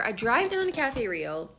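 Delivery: usual speaking voice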